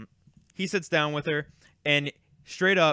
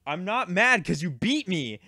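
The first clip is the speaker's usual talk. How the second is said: slow, emphatic retold protest, pitched up; mock-exasperated, higher and drawn out; exasperated retort; 4 semitones higher than usual. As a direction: spell it out to an annoying kid. Retold quarrel with the kid.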